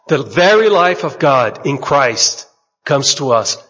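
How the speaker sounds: ordinary delivery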